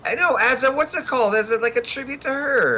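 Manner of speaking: squeaky high falsetto voice. Acted. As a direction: pipe it up way high. Falsetto high-pitched joking.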